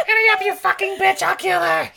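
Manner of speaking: high, loud frantic threat; frantic shouting hostage taker. Acted. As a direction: scream the threat high and wild. Villain hostage threat acted.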